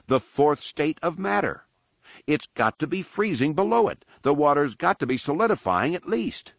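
high, eager rush of realization; excited squeal. A narrator's reading. Anakin explaining the freezing planet to crew, high squeal. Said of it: "Anakin squealed".

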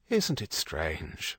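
slow, soft musing. A narrator's reading. Father Michael watches the birds, quiet slow musing. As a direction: wonder aloud, lightly voiced and unhurried. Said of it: "Father Michael mused".

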